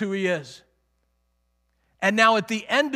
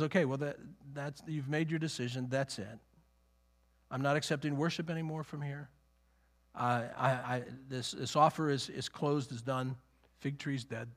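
low, much softer, resigned verdict; quiet finality; 6 semitones lower, 9 dB quieter than usual. Acted. Preacher voicing Jesus withdrawing his offer, quiet and final. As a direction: close the matter quietly, voice dropped low.

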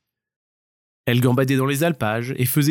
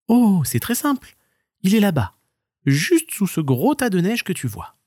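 high stoat; light high answer; 7 semitones higher than usual. Acted. Stoat answers the marmot, high and light.

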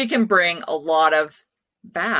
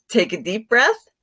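calm breathing cue; 4 semitones higher than usual. Shown as a demonstration. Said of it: "guided relaxation".

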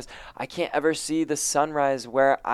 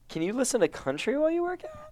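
strained, hoarse from screaming; hoarse cry; 13 semitones higher, 5 dB quieter than usual. Real, not acted. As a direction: voice cracking high on a lost voice. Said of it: "Oh my God, my voice".

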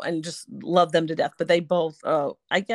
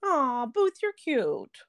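cat voice; 6 semitones higher, slower than usual. Retold or imitated. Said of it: "do you have a cat voice".

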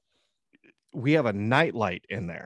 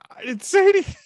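very high, loud squeal; squeaky outburst of protest; mock-defensive yelp, pitch through the roof; flustered denial; 9 dB louder, faster than usual. Dad's flustered denial, retold.

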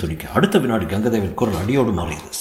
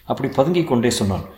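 ordinary delivery, nothing marked

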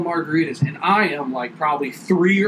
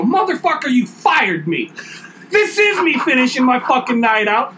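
much higher, louder retold outburst; telling off boss; 8 semitones higher, 4 dB louder than usual. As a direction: let the boss have it. Telling off the boss who fired him.

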